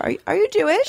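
high-pitched curious stranger; imitated stranger's question. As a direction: imitate someone asking, voice jumping up high. Imitates stranger stopping her.

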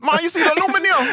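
high-pitched joking call to mother; mock calling out. Joking to mother about the aluminium, high mock question. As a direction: pipe it up high, playing with the new word. Joking use of the new word.

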